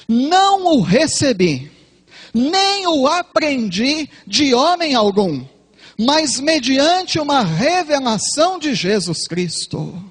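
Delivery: solemn slow Bible reading; scripture reading. Acted. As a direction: read the verse slowly, like proclaiming it. Reads the Bible verse aloud.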